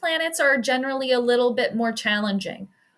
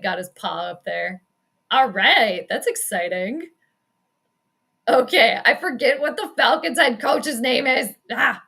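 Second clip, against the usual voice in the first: usual speaking voice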